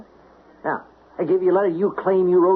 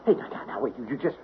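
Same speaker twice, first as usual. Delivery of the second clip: frightened pleading; 3 dB quieter, faster than usual. Acted. Mailman faced with a gun.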